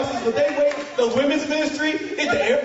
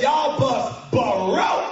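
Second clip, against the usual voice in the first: hugely stretched country drawl; much slower than usual. Shown as a demonstration. Mechanic declaring the bus dead, huge country drawl. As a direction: stretch it to the limit. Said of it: "extra syllables, it's for emphasis".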